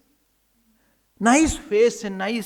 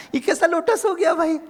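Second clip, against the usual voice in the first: higher and louder, startled and delighted; amused surprise; 8 semitones higher, 6 dB louder than usual. Acted. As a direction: exclaim in delighted astonishment. Radha startled by hugging lotus.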